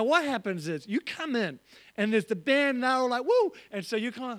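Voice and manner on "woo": high loud cheering whoop; excited woo. Retold or imitated. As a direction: whoop like the band hyping the crowd. Band cheering at church.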